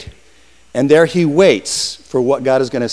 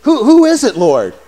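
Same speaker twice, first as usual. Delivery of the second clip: startled Saul; 8 semitones higher, 6 dB louder than usual. Acted. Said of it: "he hears this voice".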